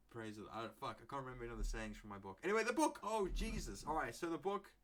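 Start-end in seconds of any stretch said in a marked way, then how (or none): 2.4-4.7 sudden excited return. Real, not acted.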